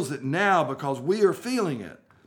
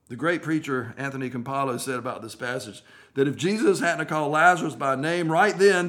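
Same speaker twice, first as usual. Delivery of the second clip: normal talking voice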